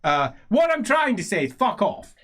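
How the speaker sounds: loud halting outburst in character; blustering old nobleman. Acted. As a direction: bluster bluntly, past caring. Same old character loses patience.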